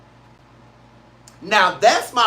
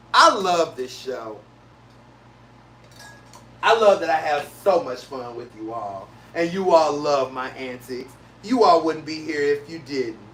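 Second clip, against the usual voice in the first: fond low tone; 7 semitones lower, 3 dB quieter than usual. Real, not acted. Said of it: "speaker's voice drops lower, fond".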